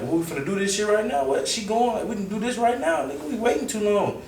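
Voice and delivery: high, rushed, incredulous complaint; high-pitched, fed-up urging; impatient protest. Retold or imitated. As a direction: whine it high, itching to act now. Impatient quote of himself.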